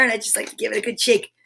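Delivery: ordinary talk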